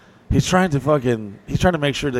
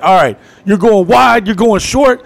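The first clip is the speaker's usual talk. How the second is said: higher, louder play-calling; quarterback calling plays; 9 semitones higher, 6 dB louder than usual. Retold or imitated. Driver as quarterback directing his friends, loud play-calling. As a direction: bark the routes like a QB. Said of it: "He's like".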